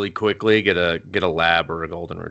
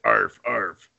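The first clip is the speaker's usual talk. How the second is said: hoarse dog bark. Shown as a demonstration. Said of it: "Ugh, I gotta stop smoking".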